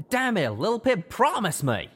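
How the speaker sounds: high, loud, pleading rebuke; he demands she promise, voice up; angry demand. Acted. Calamity scolding Lil Pip.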